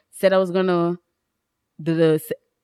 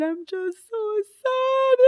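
mock crying; 12 semitones higher, 5 dB quieter, much slower than usual. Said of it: "I started crying".

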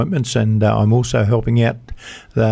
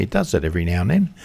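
ordinary delivery

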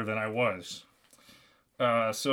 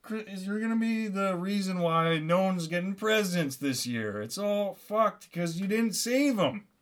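high, whiny mock complaint; mocking imitation of a disappointed listener; objecting listener; 6 semitones higher than usual. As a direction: whine like an accusing audience member. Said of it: "You're saying".

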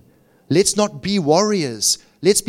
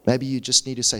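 ordinary delivery

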